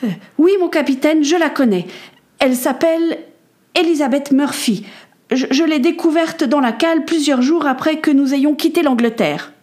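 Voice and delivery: hesitant start, then firmer confession. Acted. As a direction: explain steadily despite the nerves. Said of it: "expliqua d'une voix plus assurée".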